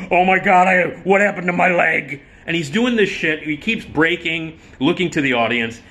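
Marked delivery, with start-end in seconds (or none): 0.1-2.2 loud, higher mock alarm; hammed-up distress. Comic faking a leg injury onstage, hammed-up. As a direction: overplay the pain for laughs. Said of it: "put his leg on an ottoman and went".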